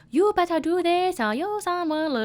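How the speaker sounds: caricatured Chinese accent from a bad script; imitated Chinese accent. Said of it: "wrote out the accent".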